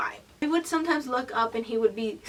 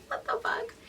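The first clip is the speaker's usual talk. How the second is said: soft, high squeak of confusion; small high puzzled voice; high-pitched bafflement; 12 semitones higher, 5 dB quieter than usual. Retold or imitated. Retold confusion at unknown number.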